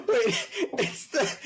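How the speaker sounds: high, slow, breaking into laughter; laughing. Real, not acted. Trying to make a point while laughing hard. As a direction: fight through the giggles.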